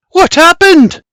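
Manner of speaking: very high, loud shock; Margaret's alarmed gasp. A narrator's reading. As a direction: cry out in alarm. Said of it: "Margaret gasps".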